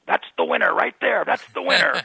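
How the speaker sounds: mock triumphant shout; imitated heckling call. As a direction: crow it from the back of the room. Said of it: "he's in the back of the room going".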